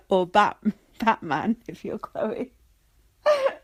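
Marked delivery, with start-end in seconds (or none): 3.2-3.5 high, squealing laugh; laughing hard. Real, not acted. Breaking up laughing at the Batman jumper. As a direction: laugh helplessly into the word.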